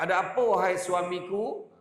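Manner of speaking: lightly mocking sweet call to a husband; joking wife playing sweet; teasing wife. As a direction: tease him with a sugary greeting. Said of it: "dia bergurau lah kan".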